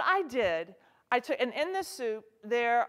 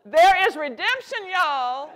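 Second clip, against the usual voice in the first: much louder and higher, like preaching good news; an emphatic, real call, louder and higher; excited rallying cry; 6 semitones higher, 12 dB louder, slower than usual. Real, not acted. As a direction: lift the room with hope. Rallying non-cooks.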